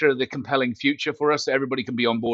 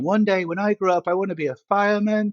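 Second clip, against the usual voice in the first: higher, mock kid voice dreaming of a job; child's dream; 8 semitones higher than usual. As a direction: play a seven-year-old announcing his future. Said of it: "seven years old, going".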